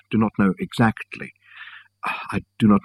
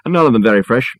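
calling out; 4 semitones higher, 4 dB louder than usual. A narrator's reading. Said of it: "called out Reg".

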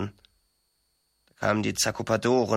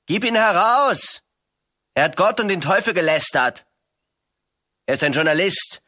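loud, higher shouting mob demand; crowd shouting; 7 semitones higher, 5 dB louder than usual. A narrator's reading. The crowd demands the prisoner from the devil, shouting loudly. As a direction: yell it at the devil like an angry crowd.

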